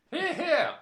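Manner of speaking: high, loud hear hear; mock yell. Justin yelling approval in the club, high loud hear hear. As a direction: bellow it like a pompous southerner. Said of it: "yelled Justin".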